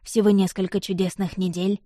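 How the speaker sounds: ordinary delivery, nothing marked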